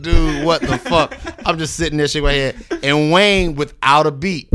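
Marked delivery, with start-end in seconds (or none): 0.0-1.2 slow, laughing what the fuck; laughing disbelief. Retold or imitated. Rapper in the meeting, laughing in disbelief. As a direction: crack up in disbelief at the question. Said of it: "I'm like".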